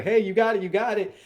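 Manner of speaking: high, loud gym spotter's encouragement; cheering spotter. Acted. Spotter urging a struggling lifter under the bar, loud high cheering.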